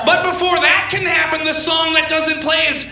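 very high, held-out mock shriek; over-the-top high-pitched delight; hammed-up high voice. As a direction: push it way up into a silly squeal. Acted hammed-up outburst over the kiss.

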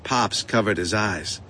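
ordinary delivery

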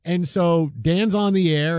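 ordinary talk, nothing marked

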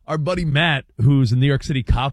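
usual speaking voice

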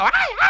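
very high-pitched cries of "ay"; mock high yelping. Fake clerk drowning out the customer, mock high yelping. Prankster wails over customer's talk.

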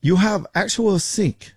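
slow, high, badly faked Filipino accent; terrible Filipino accent. Agent quizzing a customer over his sink, badly faked Filipino accent. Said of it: "That's a terrible accent".

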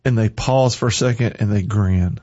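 usual speaking voice

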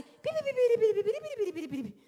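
high, loud beeping radar noise fading out; radar beeps. Shown as a demonstration. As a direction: beep like a radar scanning. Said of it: "funcionaba como un radar".

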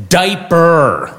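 put-on little kid coolly correcting an adult; confident toddler correction. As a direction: correct the grown-up with total assurance. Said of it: "the confidence of a serial killer".

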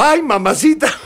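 high yelp, laughing as he tells it; startled cry. Retold or imitated. Boy tripping onstage cries out, high and laughing. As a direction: cry out as you trip, retold with a laugh. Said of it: "salgo volando y digo".

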